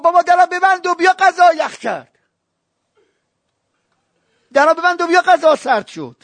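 high mocking wife calling husband in; a nagging wife's high call, told in a sermon. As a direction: play the wife calling him to dinner. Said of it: "همسرش گفت".

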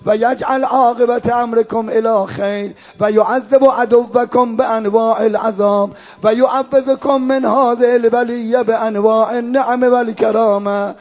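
slow Arabic chant on sustained notes; mourning recitation. Acted. Reciter voicing Hussein's farewell to his family, mourning recitation. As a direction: stretch the phrase endings in lament.

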